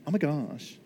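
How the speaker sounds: lightly put-on Valley Girl exclamation; slight Valley Girl swoon. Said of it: "In my Valley Girl voice".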